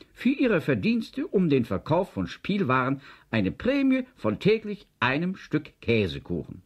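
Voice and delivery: high, ringing official proclamation; amtliche Bekanntmachung. A narrator's reading. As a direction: read the notice out with pomp, pitched high. Narrator proclaims the official notice. (feierlich verkündet, mit hoher Stimme)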